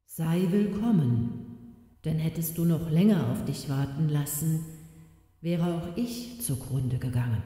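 voice from the depths. Acted. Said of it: "Da sprach eine Stimme aus der Tiefe". (langsame, leise, ferne Stimme aus der Tiefe)

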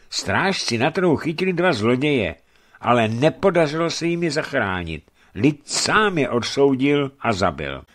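urgent report. A narrator's reading. Messenger telling merchant of the lynching, mildly agitated.

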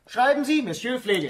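high, loud playful taunt; teasing exclaim. Acted. Baron mocks Marie's letter with a high teasing call. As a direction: tease her, pitch up.

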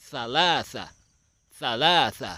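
ซาลาซะ with long, loud ลา; model pronunciation. Shown as a demonstration. Teacher shows children Arabic three, ซาลาซะ pronounced high and clearly. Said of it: "ออกเสียงว่า".

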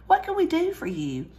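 high, sugary fake-friendly voice; false sweetness. A narrator's reading. Fake-friendly circus owner.

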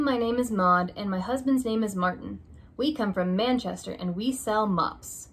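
plain reading voice

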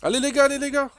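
rally the squad; urging teammates. Real, not acted. Urging teammates mid-fight.

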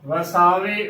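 ਵਸਾਵੇ as "va-saa-ve"; wrong three-syllable reading. Shown as a demonstration. Teacher showing the congregation's mistake, ਵਸਾਵੇ as "va-saa-ve". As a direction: join e directly to the last v of ਵਸਾਵੇ. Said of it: "ਉਚਾਰਨ ਕਰ ਦਿੰਦੀਆਂ ਨੇ".

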